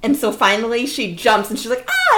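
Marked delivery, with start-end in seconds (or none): 1.9-2.2 high, short yelp of a scream; squeaky little scared yelp. Retold or imitated. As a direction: let out a tiny jump scream. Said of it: "she lets out a little scream".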